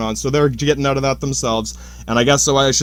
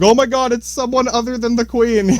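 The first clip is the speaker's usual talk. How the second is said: much higher, gasping mock amazement; mock surprise; 8 semitones higher than usual. Colonists amazed that someone other than the Queen is in charge, in a high mock squeal.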